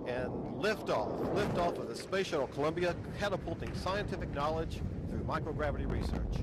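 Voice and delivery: excited liftoff call. Real, not acted. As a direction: call the launch with rising thrill. Announcer calls the liftoff.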